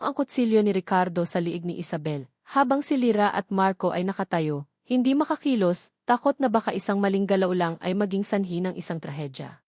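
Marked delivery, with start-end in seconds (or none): none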